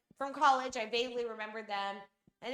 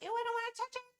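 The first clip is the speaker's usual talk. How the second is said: mock squeamish; 11 semitones higher, 3 dB quieter, faster than usual. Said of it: "some people are like".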